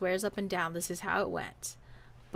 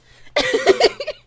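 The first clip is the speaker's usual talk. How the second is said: big high burst of laughter; loud laugh; 13 dB louder than usual. Real, not acted.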